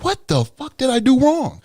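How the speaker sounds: imitating himself snubbed at the bakery; baffled. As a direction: squeak it out in mock outrage. Retold shock at the bakery.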